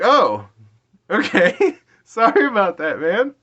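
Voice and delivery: loud, high sheepish apology; embarrassed acted apology. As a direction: replay an awkward sorry, laughing at yourself. Acts out his embarrassed apology.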